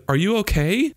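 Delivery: high, rising worried question; mild mock shout. A narrator's reading. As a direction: call it down the phone, without real volume. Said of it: "Jeremy shouted".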